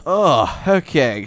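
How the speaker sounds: normal talking voice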